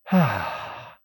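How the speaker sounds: a long high sigh ending in a loud ah; breathy release sigh then ah; demo sigh. Shown as a demonstration. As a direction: sigh it all out with a voiced ah. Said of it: "they tell you to sigh and go".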